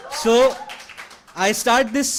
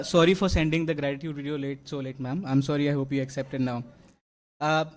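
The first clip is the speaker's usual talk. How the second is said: ordinary talk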